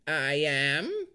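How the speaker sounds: a hint of the crone; lightly voiced hag. A narrator's reading. Hag answers.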